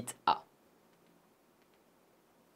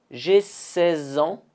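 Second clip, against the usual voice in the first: slow, higher, carefully spaced "J'ai seize ans"; slow model answer; 5 semitones higher, slower than usual. Shown as a demonstration. Teacher modeling the reply, slow and higher. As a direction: answer as a language model, slowly. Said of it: "Encore une fois".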